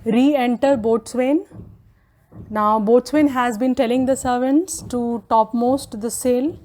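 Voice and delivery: ordinary talk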